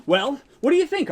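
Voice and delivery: ordinary delivery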